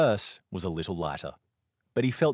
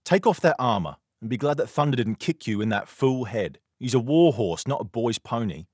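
put-on louder, higher scolding of a foolish boy; 5 semitones higher, 6 dB louder than usual. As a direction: tell the boy off firmly.

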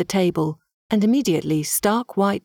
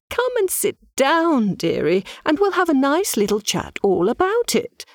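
much higher, fawning medium voice; gushing medium; 6 semitones higher than usual. A narrator's reading. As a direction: coo and flutter like a flustered fortune-teller. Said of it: "gushed on".

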